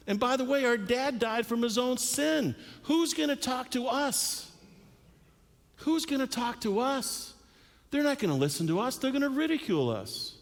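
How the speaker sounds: high, incredulous objection; doubting sisters. Pretend. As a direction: pitch way up in disbelief.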